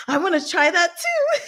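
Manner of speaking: high-pitched, laughing eager cry; eager laughing shout. Retold or imitated. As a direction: blurt it out through laughter like a keen kid. Imitating eager character, laughing.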